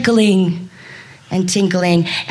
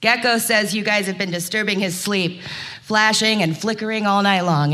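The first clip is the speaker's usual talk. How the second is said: usual speaking voice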